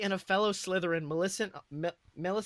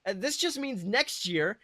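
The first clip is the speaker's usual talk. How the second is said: a lightly voiced mother, louder and a bit higher; mother reassuring her disappointed son; mom's voice; 6 semitones higher, 7 dB louder than usual. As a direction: cheer up her son after the loss. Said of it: "She finally responds with a small laugh".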